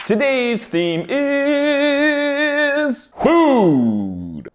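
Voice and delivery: high, slow showman announcement; announcer voice. Acted. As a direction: announce the theme like a game show host. Host announces the theme over music.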